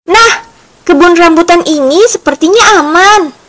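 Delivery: lightly voiced story character, pitched high; Yaki speaking. A narrator's reading. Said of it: "kata Yaki".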